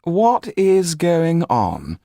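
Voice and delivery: slow, high, loud demand; demanding Marquis. A narrator's reading. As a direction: demand an answer imperiously. Marquis startled by din.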